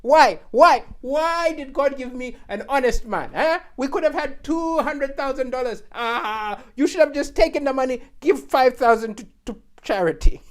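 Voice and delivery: loud, high nagging wife; hammed-up furious wife voice; exasperated wife. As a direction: berate him for turning down the money. Wife scolding her honest husband.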